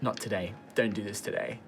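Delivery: soft and low, holding back anger; quietly warning him off. Retold or imitated. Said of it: "gets up in his face".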